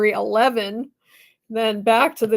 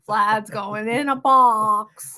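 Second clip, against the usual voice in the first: ordinary talk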